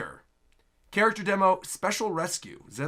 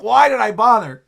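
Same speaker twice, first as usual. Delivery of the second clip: louder, higher mock exasperation; imitated frustrated complaint; exasperated player; 4 semitones higher, 10 dB louder than usual. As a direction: cry out like a let-down player. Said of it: "you're like".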